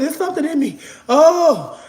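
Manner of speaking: high, tearful fake wail; hammed-up panicked wailing, badly faked; mock screaming. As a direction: cry out high as if possessed. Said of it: "I'm screaming".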